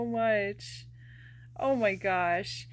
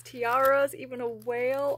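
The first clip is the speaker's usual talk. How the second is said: ordinary delivery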